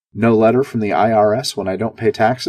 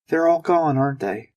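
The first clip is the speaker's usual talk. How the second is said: trembling voice; 6 semitones higher than usual. Acted. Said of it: "I heard a tremble in her voice".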